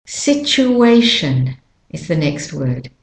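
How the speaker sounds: ordinary delivery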